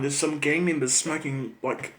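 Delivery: ordinary talk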